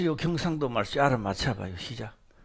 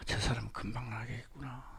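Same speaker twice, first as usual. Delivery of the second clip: whispered, unvoiced aside; whispered thought; 9 semitones lower, 17 dB quieter than usual. The pastor privately doubts a newcomer, whispered. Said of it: "나 속으로요".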